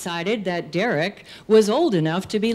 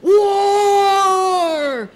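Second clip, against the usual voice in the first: high, loud, drawn-out yell; long battle holler; screaming war cry; 11 semitones higher, 6 dB louder than usual. Acted. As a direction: scream it like boys charging through woods. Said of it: "I heard the screaming and careening".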